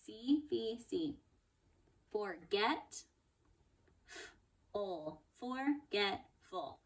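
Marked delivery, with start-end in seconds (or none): 2.1-5.2 Forget and Full said as separate chunks; chunked word. Shown as a demonstration. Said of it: "break that word up into three easy chunks". 5.4-6.8 Forgetful slow, chunks blended together; blended slowly. Shown as a demonstration. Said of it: "blend them all together".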